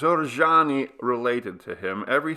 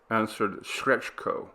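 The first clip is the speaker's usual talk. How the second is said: ordinary delivery, nothing marked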